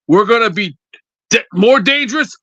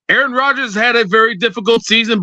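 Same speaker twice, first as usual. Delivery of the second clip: usual speaking voice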